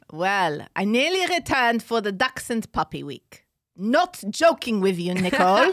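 emphatic attempt at an Israeli accent; hammed-up Israeli accent. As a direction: put on an Israeli accent, slowing for effect. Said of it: "do it in an Israeli accent".

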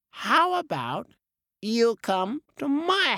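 high squawky parrot voice; a parrot inviting the cat to dinner, high and squawky. Acted. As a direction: chirp it like a bright parrot.

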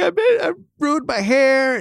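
high, far mock-sobbing wail of a kid; imitating son crying. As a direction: whimper it like a crying boy over his haircut. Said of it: "and he's crying".

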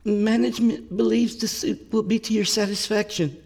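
high-pitched, shaky official announcement; timid, unsteady female character. A narrator's reading. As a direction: deliver the official line nervously in a high voice. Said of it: "her voice shaky".

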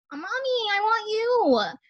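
put-on high, slow, soft child's plea; little girl pleading. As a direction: ask for mommy like a tiny child. Voices herself as little girl.

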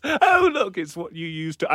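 high teasing lilt, imitating his wife; playful lightly voiced wife; mock wife voice. Husband imitating his wife teasing him over vodka, high teasing lilt. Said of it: "she always says".

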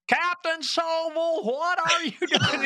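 high, drawn-out officer's call; imitated colonel. Private pranking Captain Sobel as the colonel, high slow call. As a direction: impersonate a colonel calling across a field. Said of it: "perfect imitation of the colonel".

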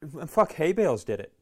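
ordinary talk

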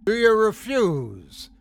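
demanding. A narrator's reading. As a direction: lean in and demand an answer. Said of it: "demanded the leader".

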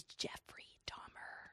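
slow spooky whisper of the topic. Acted. Whispering to the host about a grim podcast topic, slow and hushed. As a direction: hush it like a creepy secret. Ominous true crime reveal.

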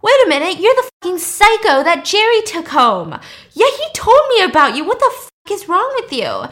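high, loud, disgusted yelling; angry guy's shout. Retold or imitated. The suit dude yelling at the avenger in outrage. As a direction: bellow it like an outraged bro. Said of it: "the suit dude is like".

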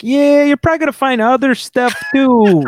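high-pitched mock Chicago cop. Cop warns homeowner about hidden stuff, Chicago voice. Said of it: "I only do this Chicago voice for a cop".

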